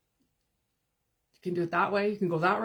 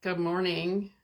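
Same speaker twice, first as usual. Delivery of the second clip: ordinary talk, nothing marked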